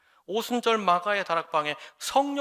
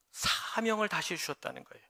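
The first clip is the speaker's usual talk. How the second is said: ordinary talk, nothing marked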